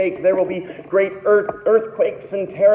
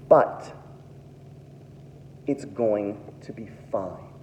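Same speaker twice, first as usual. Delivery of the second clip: softer and lower, calm and tender; gentle reassurance; 9 semitones lower, 9 dB quieter than usual. Acted. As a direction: soothe them like a shepherd. Jesus reassures the people.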